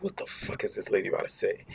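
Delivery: low, hushed aside to himself; thinking it under his breath; muttered inner thought. Retold or imitated. Narrator recalls his silent reaction, hushed and low.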